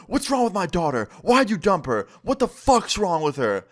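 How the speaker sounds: high-pitched mock tirade; angry outburst. Mom berating the ex who dumped her daughter, angry. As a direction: imitate a mom losing it. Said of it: "she just starts having a breakdown".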